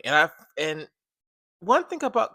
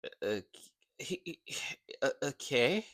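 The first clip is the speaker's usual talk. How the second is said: soft, much quieter, stammering; hesitant Almond; 8 dB quieter than usual. Retold or imitated. A light imitation of random Almond, quiet and stammering. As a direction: stumble over okay timidly. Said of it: "that's just like".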